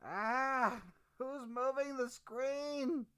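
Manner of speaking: sudden high startled yelp; startled cry. Real, not acted. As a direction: jump at the moving screen. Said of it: "startled at screen".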